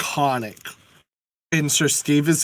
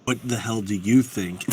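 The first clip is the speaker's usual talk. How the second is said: dragon voice; 7 semitones lower, 4 dB quieter than usual. Acted. Dragon speaks.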